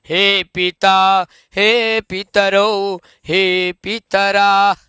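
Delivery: drawn-out, slow chanting of each हे form; lingering on हे in a slow classroom chant; slow vocative recital. Shown as a demonstration. Teacher slowly chants vocative forms for students. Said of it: "ध्यान देना है शब्द रूप".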